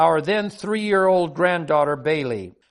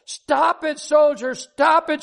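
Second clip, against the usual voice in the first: very high-pitched mock scream; hysterical child's scream; 10 semitones higher than usual. A narrator's reading. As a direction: act out a frantic little girl screaming. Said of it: "Bailey was now hysterical".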